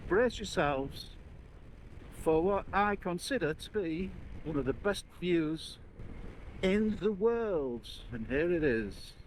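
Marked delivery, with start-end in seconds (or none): 0.1-7.9 slow, high, grand build-up; dramatic announcement. Real, not acted. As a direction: build suspense like unveiling a treasure. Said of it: "dramatic build-up to view".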